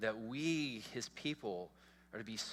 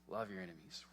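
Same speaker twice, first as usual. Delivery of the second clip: soft; 4 semitones lower, 9 dB quieter, faster than usual. Shown as a demonstration.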